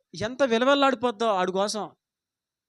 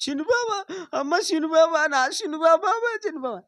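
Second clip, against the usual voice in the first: imitating wife's wailing; 7 semitones higher than usual. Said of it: "నా భార్య ఎలా ఏడిసిందంటే".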